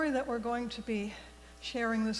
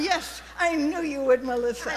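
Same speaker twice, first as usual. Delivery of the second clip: loud, higher teasing welcome to a volunteer; playful call-out; 4 semitones higher, 9 dB louder than usual. Real, not acted. Minister teasing Melissa for coming forward, loud playful call. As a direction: call her out warmly and brightly, loud and high.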